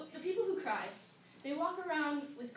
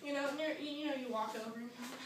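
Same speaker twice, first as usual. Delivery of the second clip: ordinary talk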